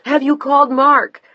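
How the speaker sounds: high, loud urgent question; frantic call. A narrator's reading. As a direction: demand it in panic. Frantic mother on phone.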